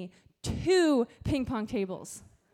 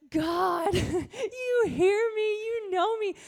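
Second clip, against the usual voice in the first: higher, slow, choked-up prayer; tearful thanks; 8 semitones higher, 5 dB louder, much slower than usual. Retold or imitated. Youth pastor reliving a thankful prayer to God, tearful. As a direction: voice breaking with gratitude.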